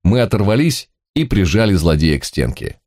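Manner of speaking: ordinary delivery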